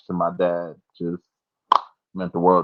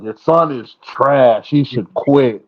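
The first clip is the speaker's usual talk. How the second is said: slow, mock-harsh put-down; mock harsh verdict; 5 semitones higher, 5 dB louder, much slower than usual. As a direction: dismiss him coldly, slowly. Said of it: "be like".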